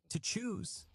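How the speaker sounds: high incredulous cry; sharp rising cry of disbelief; cried out. A narrator's reading. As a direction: fling the question up in disbelief. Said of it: "cried Giovanni".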